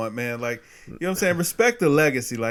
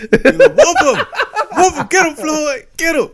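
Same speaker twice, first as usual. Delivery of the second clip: egging Floyd on between giggles; laughing cheer; 11 semitones higher, 5 dB louder than usual. Real, not acted. Friend cheering on Floyd in the fight, laughing high. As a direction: whoop him, pitched way up and laughing.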